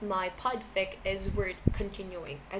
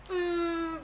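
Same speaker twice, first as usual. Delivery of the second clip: ordinary delivery, nothing marked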